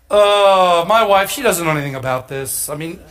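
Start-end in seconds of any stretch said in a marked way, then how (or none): none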